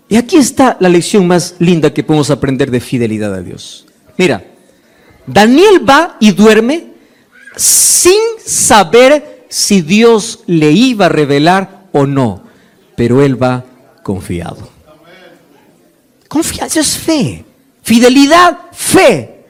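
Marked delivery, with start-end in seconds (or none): 5.2-14.7 slow and sinking. Real, not acted. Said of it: "slow sermon climax, dropping".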